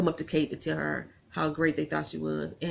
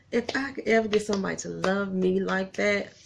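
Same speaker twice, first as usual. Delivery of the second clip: ordinary talk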